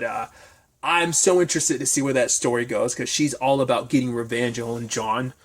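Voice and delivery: usual speaking voice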